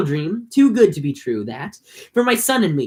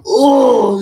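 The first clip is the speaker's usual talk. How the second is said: loud frustrated cry of oh; exasperated shout; 5 semitones higher, 7 dB louder than usual. Real, not acted. Fed up with the slow chapter, shouting in frustration. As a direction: let out a fed-up yell.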